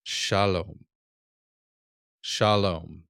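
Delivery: shalom with a long o; shalom, teaching model; Hebrew word shalom. Shown as a demonstration. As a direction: say shalom clearly. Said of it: "one you hear all the time".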